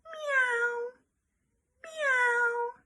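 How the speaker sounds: light cat voice; cat meow. Shown as a demonstration. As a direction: mew it like a kitten. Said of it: "This animal makes the sound".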